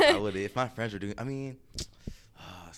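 laughing through the words. Real, not acted. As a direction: breaks into laughter mid-sentence. Laughing at own admission.

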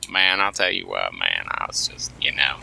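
low, soft imitated Appalachian voice; mock Appalachian talk sinking into a low murmur; Appalachian accent impression. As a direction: do an Appalachian podcaster, dropping low and quiet. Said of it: "the, uh, Appalachian guy on the podcast".

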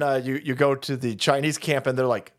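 ordinary delivery

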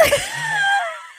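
high, screaming laugh; shrieking burst of laughter. Real, not acted. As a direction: explode into laughter at the comeback. After the friend's comeback.

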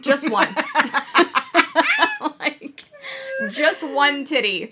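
high, loud giggly repeat; laughing. Real, not acted. Laughing at her own story.

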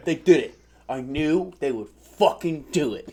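recreate your worked-up video opening, stretching the words; re-enacted video intro. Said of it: "I literally start the video".

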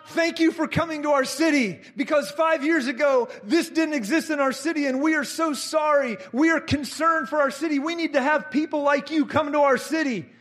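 high, impassioned plea of gratitude; retold heart cry. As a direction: pour it out like a grateful local. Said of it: "that's a heart cry".

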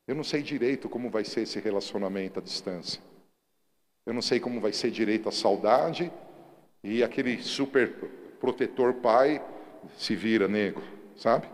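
loud, driving sermon voice; fiery pulpit preaching; preaching at full force. Acted. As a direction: preach it out to the whole church.

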